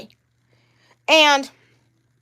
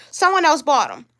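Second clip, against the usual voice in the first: ordinary delivery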